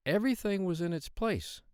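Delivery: usual speaking voice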